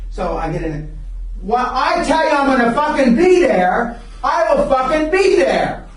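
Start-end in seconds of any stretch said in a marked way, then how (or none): 1.4-5.9 louder and higher, hammering each word; emphatic insistence. Real, not acted. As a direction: swear you'll be there no matter what. Insisting on his commitment.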